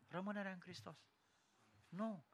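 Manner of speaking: very soft, almost under the breath; barely voiced reply; hushed answer. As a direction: drop to a tiny, hushed voice. Acted answer, repeated very softly.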